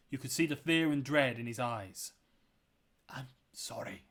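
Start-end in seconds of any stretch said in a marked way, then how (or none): 3.1-4.0 very soft, fearful whisper; hushed, guilty whisper; whispered apology. A narrator's reading. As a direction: whisper it haltingly, all breath. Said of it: "Jonathan whispered".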